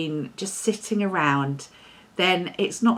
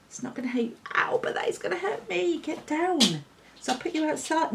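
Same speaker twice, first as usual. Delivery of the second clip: firm, quiet telling-off of the dog; strict voice; 7 semitones higher, 6 dB quieter, faster than usual. Real, not acted. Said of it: "That's my strict voice".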